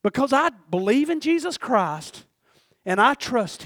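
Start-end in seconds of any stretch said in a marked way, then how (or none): none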